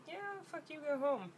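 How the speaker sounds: high, quick brush-off in a put-on voice; mock dismissive doctor. Mocking the ER doctor dismissing her limping friend. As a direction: toss it away like a bored doctor.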